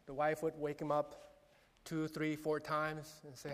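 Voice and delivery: ordinary delivery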